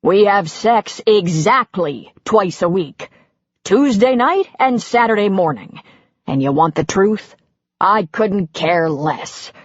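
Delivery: husband ranting about his wife's rules. Acted. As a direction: let it all boil over. Husband continuing rant.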